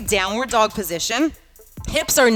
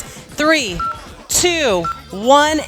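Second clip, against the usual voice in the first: louder countdown; countdown shout; 5 semitones higher, 4 dB louder, much slower than usual. Real, not acted. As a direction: count them down with force. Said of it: "counting down hard".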